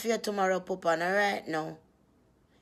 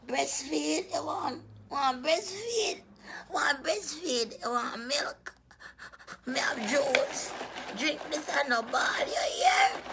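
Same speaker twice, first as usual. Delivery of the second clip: put-on high, soft cooing baby talk; 6 semitones higher, 5 dB quieter, slower than usual. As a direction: coo softly, high-pitched, as if to an infant. Babying the crying viewer.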